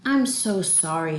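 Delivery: slow, regretful apology; remorseful apology. Acted. Grandma apologizing after settling down, remorseful. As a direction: apologize as if you feel bad. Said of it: "she'd feel bad".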